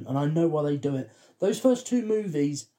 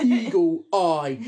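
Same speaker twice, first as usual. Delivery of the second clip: "Eagle Eye" drawn out word by word; exaggeratedly slow, separated "Eagle Eye"; 4 dB louder, slower than usual. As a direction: spell out "Eagle Eye" slowly and emphatically. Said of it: "did pronounce it that slow".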